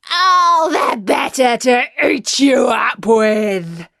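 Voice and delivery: loud, slow wolf roar; wolf's shout. Acted. Wolf pouncing on the girl with a shout.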